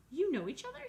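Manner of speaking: high, loud, wide-eyed little gosling; a small bird blurting out his surprise; excited gosling. A narrator's reading. Young gosling asks the robot and otter, high and loud. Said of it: "said the gosling".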